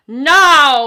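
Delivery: loud roaring shout; dinosaur yell. Acted. Storyteller to kids, a dinosaur's loud yell. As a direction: bellow it like a charging dinosaur.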